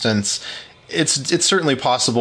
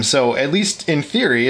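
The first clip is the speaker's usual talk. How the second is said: ordinary talk, nothing marked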